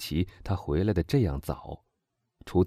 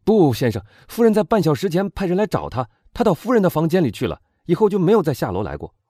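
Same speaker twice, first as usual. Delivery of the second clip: loud, fast, higher-pitched report; agitated servant; 10 semitones higher, 7 dB louder than usual. A narrator's reading. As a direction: blurt out the news to your master. Servant urgently reports to master. (仆人慌张快速地禀报)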